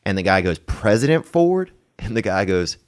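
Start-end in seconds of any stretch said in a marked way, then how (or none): 0.6-1.7 higher, drawn-out puzzled question; incredulous question. Retold or imitated. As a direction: play a baffled janitor double-checking the name. Second janitor retold as confused.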